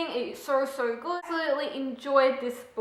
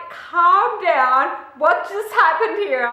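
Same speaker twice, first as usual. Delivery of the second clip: exasperated retort; 5 semitones higher, 6 dB louder, much slower than usual. Retold or imitated. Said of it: "I'd just be like".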